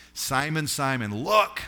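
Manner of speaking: Jesus urgently warning Simon, rising to a shout; urgent calling out. Acted. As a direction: call him sharply, rising to a cry on "look!".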